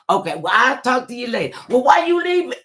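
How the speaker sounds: high, loud cheerful greeting; chirpy friendly greeter. Retold or imitated. Imitating a friend greeting cheerfully, high and loud. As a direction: sing out a bright hello. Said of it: "one of those women that says".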